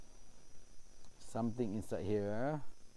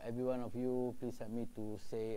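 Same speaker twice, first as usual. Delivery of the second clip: ordinary delivery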